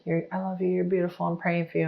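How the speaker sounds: soft, tender reassurance; softly calm an angry wife; gentle loving. Retold or imitated. Husband answering her insults, soft and tender. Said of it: "he would just be like".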